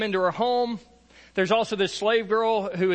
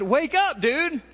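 casual wake-up call; 9 semitones higher, 3 dB louder, slower than usual. Acted. Angel wakes sleeping Peter.

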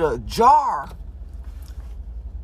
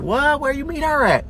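mock surprise; 8 semitones higher than usual. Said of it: "I was like".

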